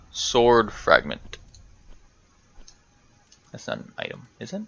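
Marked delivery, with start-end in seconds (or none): 3.5-4.6 whispered under the breath, much softer. Real, not acted. Checking an item in the menu, whispered aside. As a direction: mutter it to yourself.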